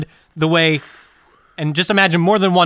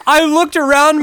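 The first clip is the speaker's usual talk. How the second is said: laughing; 9 semitones higher, 5 dB louder than usual. Real, not acted. Laughing while talking.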